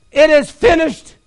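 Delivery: victorious cry. Acted. Voicing Christ's victorious cry.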